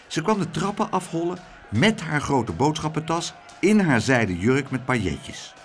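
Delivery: ordinary delivery, nothing marked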